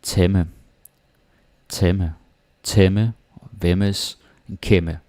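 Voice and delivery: teacher models temme, vemmes and kemme with a clear short e; Danish emme words. Shown as a demonstration. Danish teacher modelling words for learners, clear and slow. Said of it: "some examples of emme could be".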